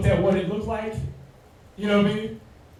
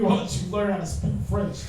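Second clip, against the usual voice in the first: ordinary delivery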